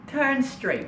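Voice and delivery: high, synthetic navigation prompt; GPS voice. Retold or imitated. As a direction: give directions as a high-pitched satnav. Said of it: "We got the G P S".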